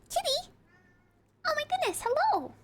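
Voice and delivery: high, squealing delight at the cat; delighted cat greeting. Real, not acted. Streamer suddenly greeting her cat, high and delighted. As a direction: coo at the kitty in a high voice.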